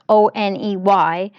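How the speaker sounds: "O N E Y" said letter by letter; "O N E Y" read as oh, en, ee, why; ticker spelled out. Shown as a demonstration. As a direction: spell out "O N E Y" as four letter names. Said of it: "however you wanna pronounce this ticker".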